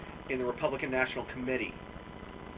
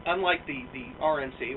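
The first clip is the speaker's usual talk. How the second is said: ordinary delivery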